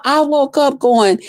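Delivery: normal talking voice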